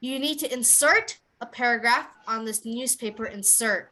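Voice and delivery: "insert" stressed at the end as a verb, "insert" stressed at the front as a noun; slow model sentence, teacher to class. Shown as a demonstration. Teacher modelling stress contrast slowly for students. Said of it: "So the way you would say this".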